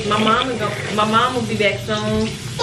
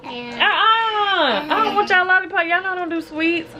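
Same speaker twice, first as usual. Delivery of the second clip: mock whine; 10 semitones higher than usual. Mock whining refusal.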